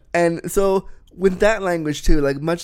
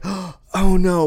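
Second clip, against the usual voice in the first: imitated gasp, higher and louder dismay; mock alarm; 4 semitones higher, 5 dB louder than usual. Speaker imitating people hearing the reclaimed word, mock alarm. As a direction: gasp as if scandalized, imitating others. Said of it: "people are like".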